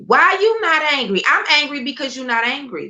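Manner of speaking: high, heated comeback; heated retort. Real, not acted. Answering critics who call her angry: a high, heated comeback.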